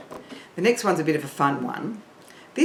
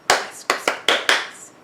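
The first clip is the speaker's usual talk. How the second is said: whispered. Shown as a demonstration. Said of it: "I'll say it, I'll do it".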